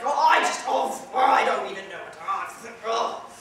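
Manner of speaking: loud, sputtering male bluster; blustery man stumbling over his indignant ohs. Shown as a demonstration. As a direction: huff and sputter in loud protest. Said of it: "men who are blustering".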